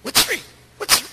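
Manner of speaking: a curt, testy retort; snappy tone of attitude. Retold or imitated. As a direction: bark the question back with impatience. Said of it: "this tone of voice now".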